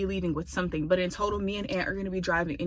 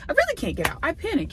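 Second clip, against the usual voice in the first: high panicked exclaim; 10 semitones higher, 10 dB louder, faster than usual. Real, not acted. Panic recounted, voice jumps.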